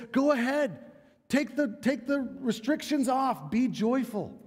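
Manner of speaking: high, bright invitation to let go; eager urging. Retold or imitated. Preacher voicing the psalmist's call to joy, high and eager. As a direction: lift the voice high and urge them on.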